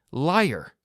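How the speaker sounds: high, loud furious scream; mother screaming accusation into the phone. A narrator's reading. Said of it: "she screamed".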